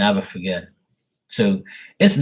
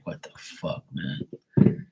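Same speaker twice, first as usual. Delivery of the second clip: whispered aside in disbelief; hushed, acted-out exasperation under the breath; baffled whisper; 4 semitones lower, 7 dB quieter, much slower than usual. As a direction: mutter it voicelessly to yourself. Acting out muttered reaction to lady.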